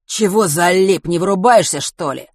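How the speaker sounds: loud, slow menacing thug voice. Acted. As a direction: lean in and threaten, slowly. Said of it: "угрожающе произнёс тот же голос".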